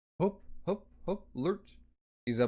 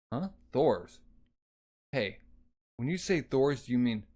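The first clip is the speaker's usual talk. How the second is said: usual speaking voice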